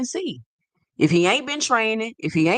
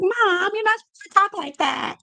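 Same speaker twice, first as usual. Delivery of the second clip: put-on much higher, scolding nine-year-old girl; scolding little daughter; 13 semitones higher than usual. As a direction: mimic a bossy little girl correcting her mom. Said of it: "my daughter, she nine, she be like".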